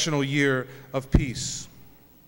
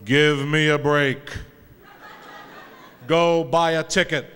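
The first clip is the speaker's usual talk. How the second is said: a slow, laughing, lightly voiced God from heaven; booming God brushing him off, amused; 3 semitones lower than usual. God answers the praying man from heaven, in a slow, amused voice. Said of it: "the voice of God, and the voice said".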